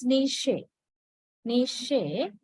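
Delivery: "niche" said nee-shay; model pronunciation. Shown as a demonstration. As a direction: say "niche" with sh and a final ay vowel. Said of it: "This is pronounced as".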